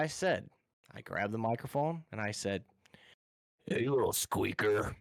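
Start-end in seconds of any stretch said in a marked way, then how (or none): 3.7-4.9 lightly put-on menacing voice to spook a kid; scary voice. Trolling a kid on the game headset, mildly creepy voice. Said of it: "Oh, the scariest thing ever".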